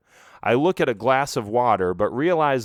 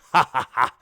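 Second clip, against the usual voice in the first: mocking villain cackle; evil laugh; 2 semitones higher, 5 dB louder than usual. A narrator's reading. Skeleton chief mocks Skeletor with a cackle.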